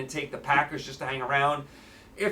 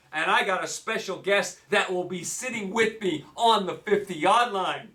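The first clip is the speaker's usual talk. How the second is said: normal talking voice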